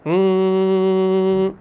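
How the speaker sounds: normal talking voice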